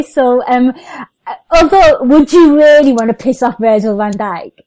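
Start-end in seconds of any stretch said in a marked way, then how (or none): 1.5-4.5 loud, incredulous question; incredulous challenge. Real, not acted. Host doubting the comedian would annoy Virgil van Dijk, incredulous. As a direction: blurt it out in loud disbelief. Challenging guest's bold claim.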